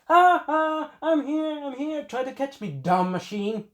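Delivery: put-on high, teasing girl's voice; taunting girl. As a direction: taunt the machine in a falsetto. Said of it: "the girl is like".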